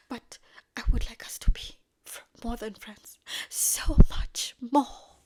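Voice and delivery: loud, hammed-up romantic longing; impassioned confession. Acted declaration of wanting more.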